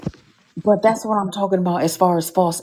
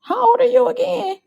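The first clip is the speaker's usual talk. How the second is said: high, astonished squeal at her videos; mock disbelief. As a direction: shriek it in amazed disbelief. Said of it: "I'm like".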